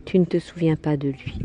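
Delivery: ordinary delivery, nothing marked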